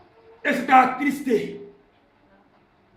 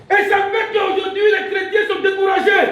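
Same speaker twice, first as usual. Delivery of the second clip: loud, high-pitched sermon cry; full-force preaching; 10 semitones higher, 8 dB louder than usual. Real, not acted. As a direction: proclaim it to the whole church. (prédication enflammée, criée)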